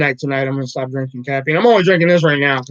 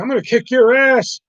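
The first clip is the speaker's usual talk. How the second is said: angry threat; 8 semitones higher, 4 dB louder than usual. Retold or imitated. Brother threatens after bathroom.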